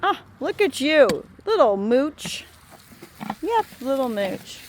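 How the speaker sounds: high, loud cooing at a calf; pet talk. Real, not acted.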